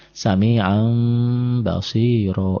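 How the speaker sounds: very slow recited "Sami'an basiro", long hummed m for the n; lengthened final vowel. Shown as a demonstration. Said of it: "Tapi panjang satu alif".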